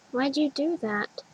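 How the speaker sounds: lightly voiced small child asking; little girl voice. A narrator's reading. Young girl asks her older self, lightly childlike.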